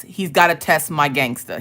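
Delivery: normal talking voice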